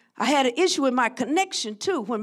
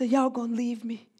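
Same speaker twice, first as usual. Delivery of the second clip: softer, lonely question; a hint of sorrow; 5 dB quieter than usual. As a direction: ask as if bracing for abandonment. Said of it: "the anticipation of abandonment".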